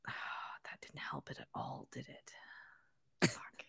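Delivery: unvoiced muttering; whispered aside. Real, not acted. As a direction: sigh and whisper it to yourself.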